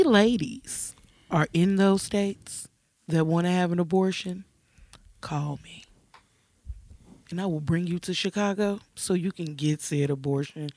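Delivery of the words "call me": softly whispered, sly. Acted. Offering help to women in those states, a sly whisper. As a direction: breathe it like a secret invitation. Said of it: "whispered offer".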